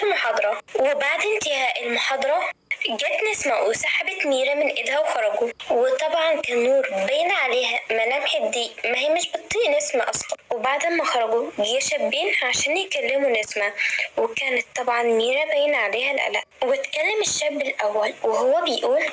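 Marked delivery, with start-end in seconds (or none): none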